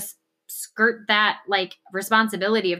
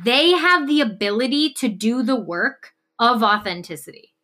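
normal talking voice

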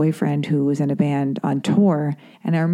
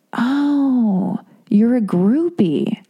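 higher, slow, smug verdict; condescending doctor; 5 semitones higher, much slower than usual. Retold or imitated. The doctor dismissing her as a groupie, slow and smug. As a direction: draw it out like a know-it-all.

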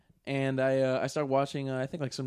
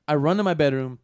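normal talking voice

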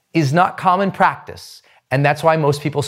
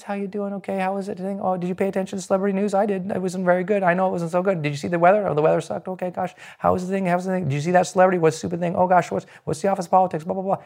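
chit-chat under your breath without projecting; not projecting; 2 semitones higher, 6 dB quieter, faster than usual. Shown as a demonstration. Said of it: "they're not projecting".

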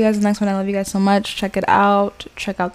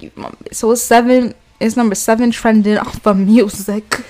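ordinary talk

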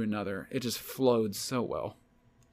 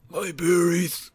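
louder, higher pained yelp; loud pained echo; 5 semitones higher, 9 dB louder, much slower than usual. Retold or imitated. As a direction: shout it back in mock pain. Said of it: "I was about to say".

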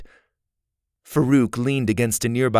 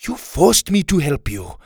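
growling; 7 semitones higher, 3 dB louder than usual. A narrator's reading. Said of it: "Gabriella growled".

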